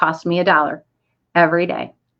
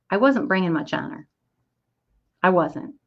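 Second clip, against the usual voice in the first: ordinary delivery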